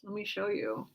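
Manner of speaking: "Let me show you" dropped to a soft, sinister hush; a horror villain quietly beckoning; creepy whisper. Retold or imitated. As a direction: lean in and menace them quietly. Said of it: "in the creepiest whisper ever".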